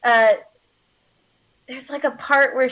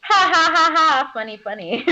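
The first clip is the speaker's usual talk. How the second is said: acted hollow amusement, trailing away; fake laugh; 5 semitones higher than usual. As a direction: laugh it off unconvincingly. Acted awkward laugh of guilty colleague.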